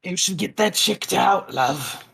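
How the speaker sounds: put-on raspy old hag voice, high. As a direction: rasp it out like a crone. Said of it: "they will say ... in a raspy voice".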